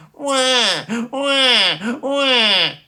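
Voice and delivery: put-on high, loud child's wah wah; bawling wails. A girl in her bedroom bawling in a tantrum. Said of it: "bad-tempered screaming and sobbing".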